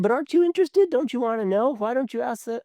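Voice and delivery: curious nagging. Retold or imitated. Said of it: "people are saying".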